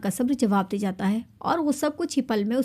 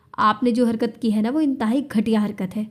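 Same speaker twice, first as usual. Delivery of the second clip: usual speaking voice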